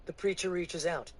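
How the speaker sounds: ordinary delivery, nothing marked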